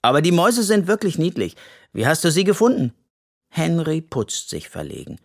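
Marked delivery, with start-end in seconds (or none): 0.0-2.9 fast, louder, excited questions; fast excited child asking; eager. A narrator's reading. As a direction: rush out the curiosity. Yoshi eagerly asks.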